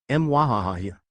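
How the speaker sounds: hammy villain cackle; evil laugh. A narrator's reading. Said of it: "acting like a cartoon villain".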